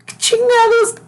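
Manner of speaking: can't believe what he sees; shocked disbelief. Real, not acted. Reacts to stethoscope in video. (incredulidad aguda)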